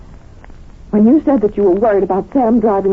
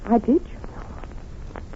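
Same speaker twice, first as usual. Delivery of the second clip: slow, much softer whisper; hushed confession; 10 dB quieter, much slower than usual. Acted. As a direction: breathe it to him in secret. Wife confides she saw him.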